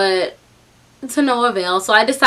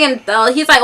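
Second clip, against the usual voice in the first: ordinary talk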